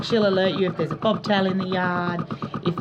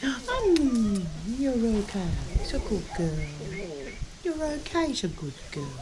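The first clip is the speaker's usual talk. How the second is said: softer, hushed pet talk; soothing; 6 dB quieter, slower than usual. Real, not acted. Owner quietly calming an animal, soft murmur. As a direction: murmur it gently to calm her.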